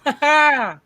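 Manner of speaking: high, loud gloating ha ha; taunting cackle; mocking laugh. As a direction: jeer at the chef on screen. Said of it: "be like".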